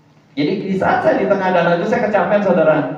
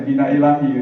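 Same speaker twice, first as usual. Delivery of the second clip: mock pious phrase.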